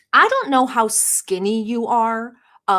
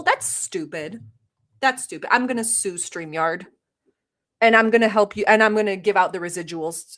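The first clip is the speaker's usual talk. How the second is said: ordinary talk, nothing marked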